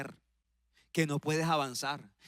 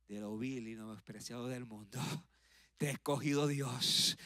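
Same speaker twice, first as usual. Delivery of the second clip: very low, hushed delivery; quiet, low divine reassurance; voice of God; 7 semitones lower, 7 dB quieter than usual. Acted. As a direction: drop to a soft, solemn near-murmur. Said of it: "aparece la voz de Dios".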